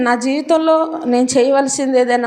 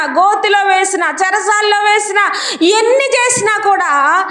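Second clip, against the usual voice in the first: full-force preaching; 6 semitones higher than usual. Acted. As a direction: proclaim it like a pulpit climax.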